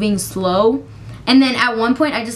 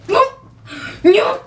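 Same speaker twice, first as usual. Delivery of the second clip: frantic nope; 11 semitones higher, 3 dB louder than usual. Retold or imitated. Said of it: "I said".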